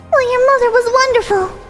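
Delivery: put-on high sweet girlish voice; bright, breathy young girl; high cute anime girl. Voiced female character.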